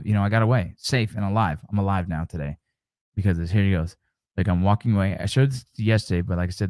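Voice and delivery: ordinary talk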